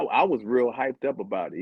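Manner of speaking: usual speaking voice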